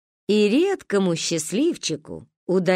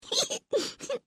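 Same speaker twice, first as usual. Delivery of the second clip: giggles; 8 dB quieter than usual. Acted. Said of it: "Захихикали мы".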